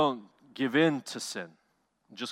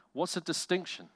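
ordinary delivery

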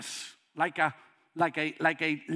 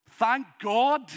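usual speaking voice

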